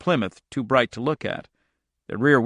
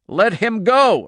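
high, loud demand; bull-throated shout; 10 semitones higher, 5 dB louder, slower than usual. A narrator's reading. Irv as Andy is dragged away, bull-throated shout. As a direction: bellow it like a bull. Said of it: "Irv Mander shouted, bull-throated".

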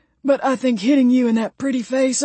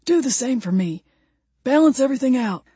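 raised-pitch appeal; insistent character plea; imploring; 6 semitones higher than usual. A narrator's reading. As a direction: plead for the same favour. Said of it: "Ray implored".

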